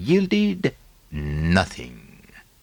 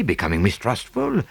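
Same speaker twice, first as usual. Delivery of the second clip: usual speaking voice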